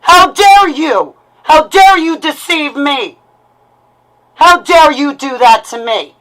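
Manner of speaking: cornered and outraged, not holding back; furious accusation. Real, not acted. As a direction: hurl each how dare you at them. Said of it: "what you just heard is a lash out".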